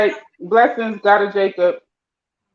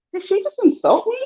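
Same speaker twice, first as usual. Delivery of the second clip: high-pitched mock bewilderment; imitated puzzled listener; 14 semitones higher than usual. Playing someone unsure they were insulted, mock puzzled. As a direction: question it in a high put-on voice. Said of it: "you be like".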